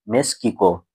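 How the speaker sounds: মেক্সিকো said as meskiko with k and s swapped; mispronounced Mexico. Shown as a demonstration. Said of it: "মেক্সিকোকে আমরা বলি".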